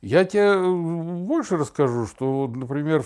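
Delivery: normal talking voice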